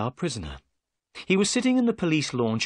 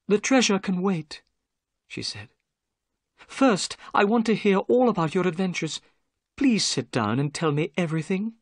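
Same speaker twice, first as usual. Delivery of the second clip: higher lady's voice, eager; higher, keen to hear his story; 8 semitones higher than usual. A narrator's reading. Miss Morstan asks the narrator for his adventures, higher eager voice.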